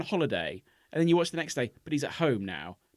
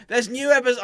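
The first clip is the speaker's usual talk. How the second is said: shouting upstairs; 10 semitones higher, 8 dB louder than usual. Retold or imitated. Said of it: "I shouted up the stairs".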